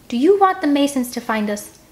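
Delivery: high, loud stern rebuke; stern scolding. Acted. Edge scolding the noisy scouts, stern rebuke. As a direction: scold them sharply.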